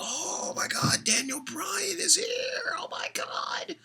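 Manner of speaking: breathless high squealing whisper, hammed up; mock fangirl squeal. Vlogger mocks fans gushing over Daniel Bryan, breathless squeal. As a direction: gush like a starstruck fan, mocking them.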